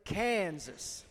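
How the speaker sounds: "Kansas" slowly stretched into a drawl. Shown as a demonstration. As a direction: drag out the first vowel of "Kansas". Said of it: "kinda get a drawl".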